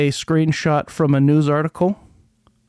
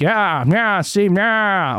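put-on higher, old-fashioned newsreel gangster voice; old-timey twenties voice; 5 semitones higher, much slower than usual. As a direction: camp it up like a 1920s newsman. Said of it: "should I read it in my twentieth voice".

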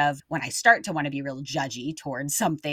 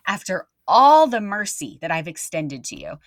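incredulous reproach; 4 semitones higher, 7 dB louder than usual. Acted. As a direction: confront them in stunned disappointment, leaning on the words. Landowner confronting the merciless slave.

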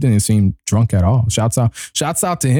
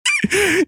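high laugh. Real, not acted. Bursting out laughing.

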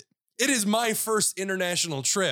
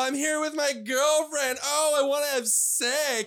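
mocking brother; 6 semitones higher than usual. Teasing his brother as a lovestruck party pooper, whiny. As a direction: mimic him as a smug boyfriend. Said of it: "Ryan was being a party pooper".

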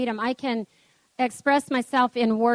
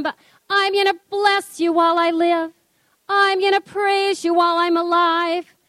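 higher, slow, lifted praying voice; worship prayer; 5 semitones higher, 3 dB louder than usual. Acted. Worship leader praying the psalm over the congregation, slow lifted praise. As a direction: pour it out as praise to the Lord.

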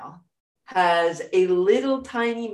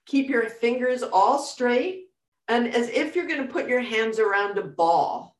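guided meditation; 3 dB quieter than usual. Acted.

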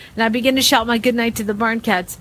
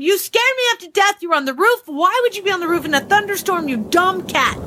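angry scolding; 8 semitones higher than usual. A narrator's reading. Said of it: "I screamed at him".

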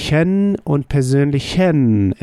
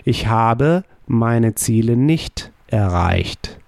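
slow, deliberate repeat of the sentence. Shown as a demonstration. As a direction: repeat it slowly to drive it home. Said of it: "we use haben as the auxiliary verb".

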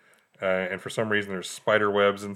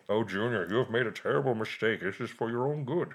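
ominous father; 5 dB quieter than usual. Retold or imitated. Father voiced in retelling.

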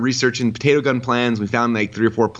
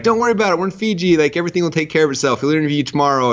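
high-pitched, carefree brush-off; breezy reassurance; 8 semitones higher than usual. Retold or imitated. Retelling Fiji hosts soothing a guest, breezy reassurance. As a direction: wave the worry away in a raised voice. Voices organisers brushing off missed flights.

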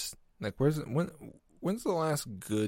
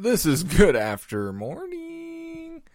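higher, slow show-title announcement; announcer voice; 13 semitones higher, 10 dB louder, slower than usual. Pretend. Host announces his show title, higher and slow. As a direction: announce the show name grandly.

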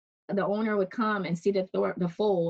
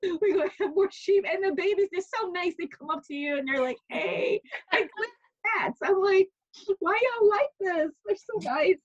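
high, delighted gushing; much higher, fond and laughing; 12 semitones higher than usual. Real, not acted. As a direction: coo over the sweet lambs. Gushing about baby sheep.

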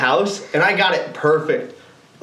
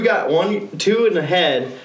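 normal talking voice

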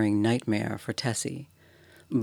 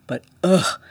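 disgusted ugh; 8 semitones higher, 8 dB louder than usual. Acted. Disgust at the cold head.

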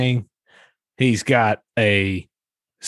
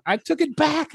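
high-pitched laugh through words; squeaky laughing take; snorting laughs; 14 semitones higher than usual. Real, not acted. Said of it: "did I just snort".

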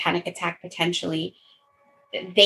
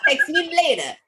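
laughing mock casual; 6 semitones higher than usual. Continues the bit laughing.

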